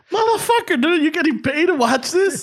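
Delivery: taunting the guard for not chasing; mock outrage. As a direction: scoff at him in disbelief.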